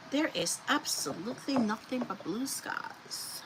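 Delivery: hushed aside, breath only; unvoiced dramatic whisper about the sky. Real, not acted. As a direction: whisper it like a secret punchline. Dramatic aside about clear weather.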